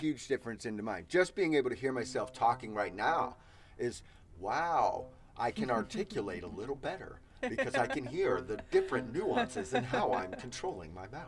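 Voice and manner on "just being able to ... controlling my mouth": smooth, soft radio announcer voice; announcer-style, carefully articulated and soft. Real, not acted. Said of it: "You sound like a radio announcer guy".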